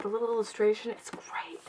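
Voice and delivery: breath-only whispered praise; hushed admiration. Real, not acted. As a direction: drop into a whisper of approval. Hushed admiration of book design.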